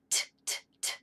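short unvoiced T clicks; crisp T taps. Shown as a demonstration. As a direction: tap out "T" like a tooth. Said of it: "tap his tooth like this".

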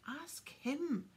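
lightly voiced shriek, pointing at Harry. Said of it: "shrieked Filch".

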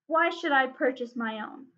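ordinary delivery, nothing marked

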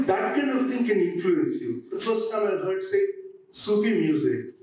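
ordinary talk, nothing marked

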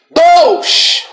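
explosive high-pitched cry; shouted invocation. Real, not acted. Preacher calling God down on the spirit, shouting. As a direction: hurl the word out high, drawn out. Crying out during deliverance.

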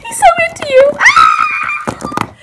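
high gushing shriek; giddy squealing. Acted. Friend tells girl he likes her, giddy squeal. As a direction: build into a fangirl scream. Friend gushes about the crush.

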